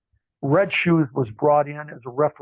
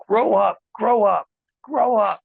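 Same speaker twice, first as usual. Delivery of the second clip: put-on louder, higher scolding in Pat's French-Canadian accent; 6 semitones higher, 5 dB louder, slower than usual. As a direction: bark it like an exasperated boss. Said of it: "And Pat says".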